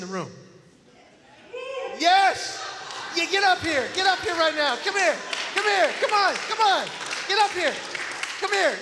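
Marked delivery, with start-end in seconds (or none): 2.0-8.8 high, loud, delighted shouts in short bursts; excited calling out. Real, not acted. Speaker calls a Herbert up from the room, excited shout. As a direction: urge them up to you, loud and high.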